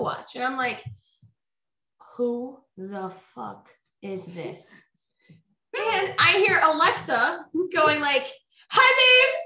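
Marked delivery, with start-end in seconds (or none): 2.2-4.7 slow, low and hushed bafflement; retold disbelief. Retold baffled reaction to walkie-talkie. 8.7-9.4 very high, loud mock greeting; imitated cheery call. Imitating Alexa calling on walkie-talkie.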